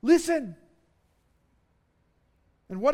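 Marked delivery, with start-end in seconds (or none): 0.0-0.6 loud, high command to listen; urgent listen. Shown as a demonstration. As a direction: snap their attention back. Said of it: "The effect is this".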